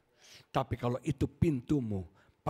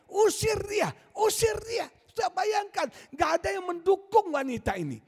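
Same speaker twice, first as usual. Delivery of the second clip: full-force preaching; 11 semitones higher than usual. Acted.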